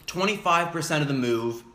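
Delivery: ordinary talk, nothing marked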